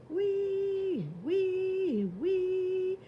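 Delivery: high siren wee wee; siren wail. Acted. Little fire engine sounding its alarm, high siren wail. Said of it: "I sound my alarm".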